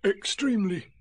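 drowsy Pooh. Acted. Pooh jolted awake answering Rabbit, sleepily. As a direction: answer as if just woken. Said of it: "opened his eyes with a jerk".

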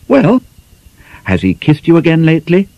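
sly schoolgirl teasing her friend; teasing young girl. Acted.

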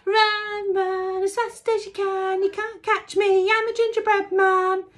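high, loud teasing chant; shouting taunt. A narrator's reading. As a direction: shout the taunt over your shoulder. Final taunt.

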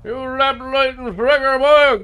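put-on thick rural accent, heavy drawl. Local insists this is how he always talks, thick rural accent. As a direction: lean hard on the rural accent and the boy.

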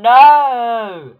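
high, loud cry of dismay; dismayed shout. Real, not acted. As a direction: shout it out as the game goes wrong. Sudden setback in the game.

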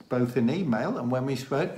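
ordinary delivery

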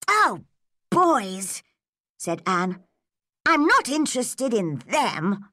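put-on high-pitched girlish scorn; little girl pooh-poohing the idea; dismissive. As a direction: brush it off with a high, emphatic "Oh". Girl scorns talk of boys.

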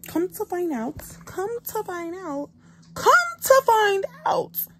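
building comic reveal. Real, not acted. Vlogger revealing cat's secret, mounting dramatic shout. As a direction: repeat it bigger each time, ending in a drawn-out yell.

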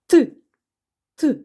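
ordinary talk

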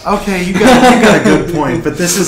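loud protest. Real, not acted. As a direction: push the point loudly. Arguing the game is fiction.

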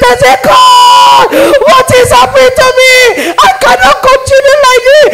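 high-pitched, loud anguished shouting; screaming in agony. Retold or imitated. Sinner in hell crying to God, screaming in agony. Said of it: "I was screaming, shouting".

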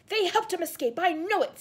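loud, high furious howl; enraged howl. A narrator's reading. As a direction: howl the accusation with rage. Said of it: "Snape howled".

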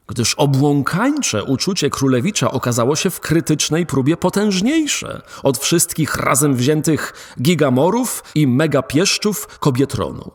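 ordinary delivery

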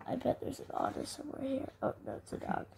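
fast hushed whisper aside. Acted. Reader whispering a joke to the child.

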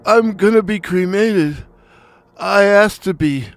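high, wheezy deathbed request; wheezing. Acted. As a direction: wheeze out your last wishes. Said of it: "he wheezed".

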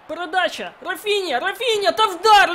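loud urgent commands; yelling at the players. Real, not acted. Gamer yelling at his team to shoot. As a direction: shout them on to shoot. Urging players during match.

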